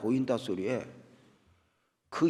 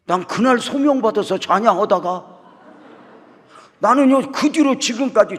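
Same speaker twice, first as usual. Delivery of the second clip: emphatic punchline; 9 semitones higher, 10 dB louder than usual. Real, not acted. Preacher punchline to congregation.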